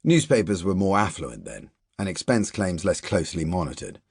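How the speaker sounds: plain reading voice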